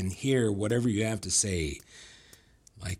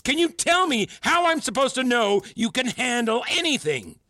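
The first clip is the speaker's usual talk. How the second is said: worked-up tirade; 12 semitones higher, 3 dB louder than usual. Acted. Mentor accusing student of expecting mind-reading, agitated tirade. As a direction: voice pitched way up in agitation.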